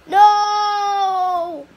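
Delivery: loud, high cry of dismay; alarmed shout. Real, not acted. Gamer watching his avatar fall, alarmed shout. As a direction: yell it as things go wrong. Character jumps out of world.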